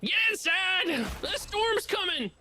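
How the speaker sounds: high, slow hammed-up Tornado Alley warning; Tornado Alley accent. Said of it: "your Tornado Alley accent".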